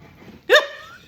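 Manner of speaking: high loud burst of real laughter; shrieking laugh. Real, not acted. Cracking up at the skirt fire, high laugh burst. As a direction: let a squealing laugh escape.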